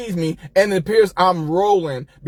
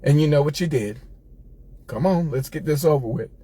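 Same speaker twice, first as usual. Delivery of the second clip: low, quiet, stern auntie; low and menacing, a calm sentence before punishment; 7 semitones lower, 4 dB quieter than usual. Retold or imitated. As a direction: auntie calling him in for a whipping, low and stern. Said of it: "She said".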